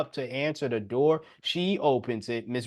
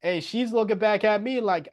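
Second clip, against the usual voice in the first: ordinary delivery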